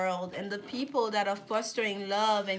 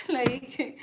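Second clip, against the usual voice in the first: laughing; 3 semitones higher, 4 dB louder than usual. Real, not acted. Laughs off the emotion.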